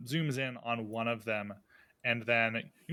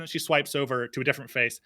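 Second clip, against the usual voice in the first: ordinary delivery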